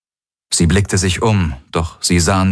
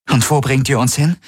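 pitched-up demand to captors; challenging question; 8 semitones higher than usual. A narrator's reading. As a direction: ask it higher, confronting the guards. Captive questions her guards.

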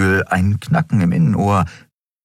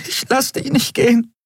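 pleading woman. A narrator's reading.

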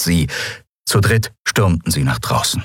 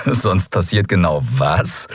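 slow, amused challenge; slower than usual. A narrator's reading. Gunman calling the woman's bluff, amused. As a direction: draw the question out, amused. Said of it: "fragte er amüsiert".

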